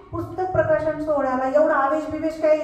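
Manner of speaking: ordinary delivery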